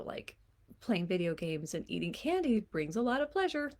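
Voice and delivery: usual speaking voice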